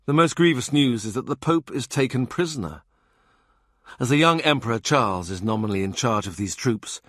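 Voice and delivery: ordinary delivery, nothing marked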